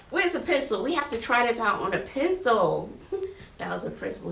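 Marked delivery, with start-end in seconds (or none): 3.6-4.3 whispered, much softer; hushed aside. Real, not acted. Teacher whispering to viewers that the principal came by. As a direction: confide it under your breath.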